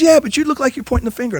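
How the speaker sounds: high-pitched, animated mock scolding; animated Monty Kiffin. Old coach chewing out his assistant, high animated scolding. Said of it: "Monty was always animated".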